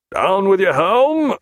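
loud, high, drawn-out order; captain's sustained shout to the helm; called command. A narrator's reading. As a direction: call the order across the deck. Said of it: "called Jack".

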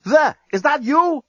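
high, loud call from another cell; urgent high shout. Acted. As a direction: call down the row, pitch way up. Calling between prison cells.

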